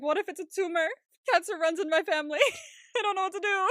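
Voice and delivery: high mock panic, breaking into laughter; freaking out. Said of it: "he's freaking out".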